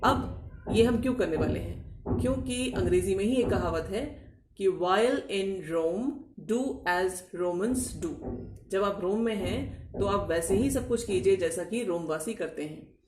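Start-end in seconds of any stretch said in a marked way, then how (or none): 4.7-8.3 very slow, word by word; proverb, slowly. Shown as a demonstration. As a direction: lay out each word of the proverb. Quoting English proverb slowly.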